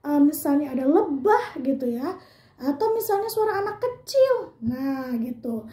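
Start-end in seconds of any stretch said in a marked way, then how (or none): none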